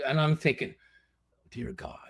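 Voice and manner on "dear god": whispered, pleading aside; hushed prayer. Retold or imitated. Contestant praying for good news on the phone, hushed. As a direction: imitate a private prayer under the breath. Silent plea while awaiting verdict.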